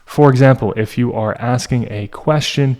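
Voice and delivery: ordinary talk, nothing marked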